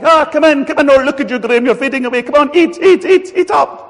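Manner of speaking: loud, high, fussing Italian granny. Retold or imitated. Granny urging the preacher to eat up, loud and high. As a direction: push food on him like a doting nonna. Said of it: "you go to Italian granny".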